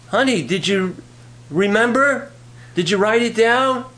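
high, questioning call to another room; acted wife calling her husband; mock wifely call. Wife calls to absent husband.

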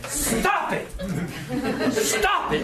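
high, sharp scolding shout, laughing through it; scolding cry. Retold or imitated. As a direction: shake him and cry stop it. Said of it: "like that".

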